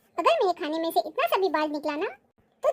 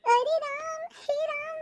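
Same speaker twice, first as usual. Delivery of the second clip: put-on higher pitched old woman's wail of dismay; mock outrage; 8 semitones higher than usual. Grandmother wailing at her daughter-in-law's food, higher and hammed up. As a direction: cry out to the heavens about the food, hammed up.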